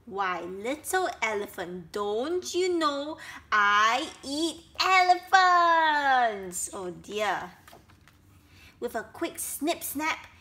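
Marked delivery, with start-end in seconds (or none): 0.1-6.7 slow menace building to a loud roar; dangerous crocodile. A narrator's reading. Crocodile to little elephant, menacing then pouncing. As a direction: start sly, end in a long drawn-out loud cry. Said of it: "Crocodile smiled a dangerous smile".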